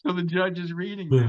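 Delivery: usual speaking voice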